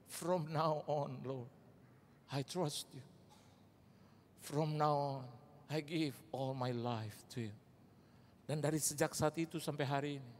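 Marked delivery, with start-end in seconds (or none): 0.1-7.6 soft, slow, humble prayer; prayer of surrender. Retold or imitated. Preacher praying alone in his room, soft and slow. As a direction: surrender your life quietly to the Lord. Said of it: "saya ingat kata-kata saya".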